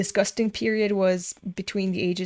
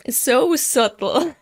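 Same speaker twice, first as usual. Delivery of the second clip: sarcastic, breaking into laughter; laughing sarcasm; 5 semitones higher, 5 dB louder, slower than usual. Real, not acted. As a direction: laugh through the words. Mocking the hints, laughing.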